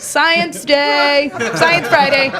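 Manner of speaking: high, sing-out mock announcement; hammed-up grown-up enthusiasm; playful hype. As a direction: hype it up for a little kid. Acted adult hyping up a child.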